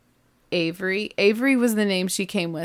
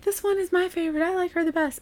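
put-on higher, softer girlish voice; little girls; 9 semitones higher, 4 dB quieter, faster than usual. Girls showing their aunt their favorite doll, higher. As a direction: sweetly show off your pick. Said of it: "they all are like".

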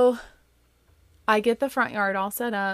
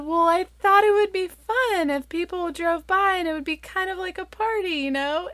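high, lightly pleading explanation; placating mom; 12 semitones higher, 3 dB louder than usual. Retold or imitated. Mom explaining the honk sign to her daughter, placating. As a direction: justify yourself to an upset kid, pitched high. Mother placates upset child.

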